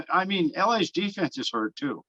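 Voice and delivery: usual speaking voice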